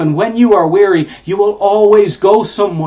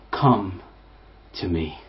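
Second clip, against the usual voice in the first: hushed invitation; 13 semitones lower, 10 dB quieter than usual. Acted. Jesus's words, preacher hushes.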